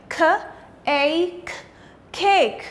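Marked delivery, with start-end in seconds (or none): none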